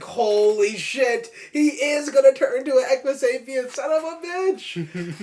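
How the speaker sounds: slow, loud, high disbelieving outburst; drawn-out, rising yell of shock; shocked exclamation. Retold or imitated. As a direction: blurt it out like the twist just hit you. Retells shocked reaction at movie twist.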